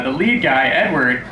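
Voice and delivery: ordinary talk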